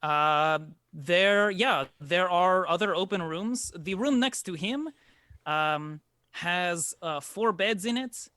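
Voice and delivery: put-on a courteous host introducing himself and his boy; lightly voiced formal innkeeper; innkeeper Nino.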